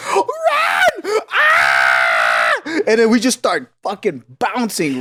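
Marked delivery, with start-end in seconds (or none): 0.1-2.6 mock-terrified high shriek; panicked scream. Friend warning him to flee the dad, screaming in panic. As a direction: yell the warning, then a long scream.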